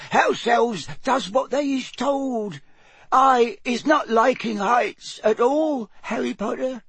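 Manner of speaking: very slow, high little voice; firm elf squeak. A narrator's reading. Dutiful house elf admits fearing heights, squeaking slowly. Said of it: "said Winky firmly from behind her hands".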